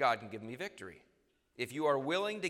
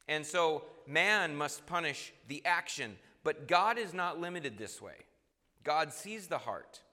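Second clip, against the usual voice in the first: ordinary delivery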